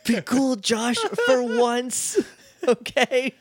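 high, teasing shush; playful scolding. Acted. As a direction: hiss at him to behave, laughing. Teasing co-host to behave.

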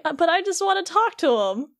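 a hint of naive young hero pleading; mock Sora. Friend retells Sora wanting only to talk, high mock voice.

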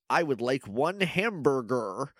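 slow, over-flattened fake American accent. Mocking people attempting to sound American, flattened accent. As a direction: imitate foreigners flattening out American English, drawn out. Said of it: "flatten out everything".

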